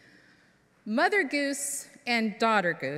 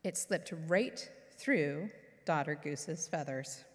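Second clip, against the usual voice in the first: usual speaking voice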